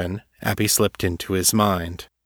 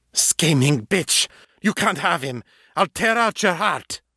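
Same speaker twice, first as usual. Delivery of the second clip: furious ghost; 9 semitones higher than usual. A narrator's reading. Jealous ghost lunging at Abby, shrieking in fury. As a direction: tear into her like a jealous ghost.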